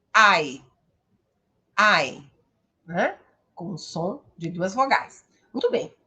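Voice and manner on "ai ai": "ai" said twice as one gliding diphthong. Shown as a demonstration. Teacher showing students the English vowel sound. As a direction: model the "ai" glide from a to i. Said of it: "essa vogal é pronunciada".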